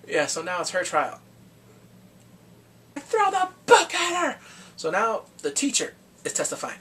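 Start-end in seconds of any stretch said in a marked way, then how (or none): 3.0-4.4 high, loud call for a harsh sentence; yelling. Real, not acted. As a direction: shout it at the screen for justice. Viewer yelling at the trial.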